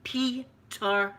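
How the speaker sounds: PETER drawn out in two separate syllables; slow repeat. Retold or imitated. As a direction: repeat PETER slowly, as if misheard.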